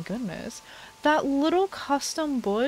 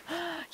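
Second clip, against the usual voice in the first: sharp delighted gasp; a soft high gasp of delight; 8 semitones higher, 10 dB quieter than usual. Real, not acted. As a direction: catch your breath in wonder. Sees heart lake.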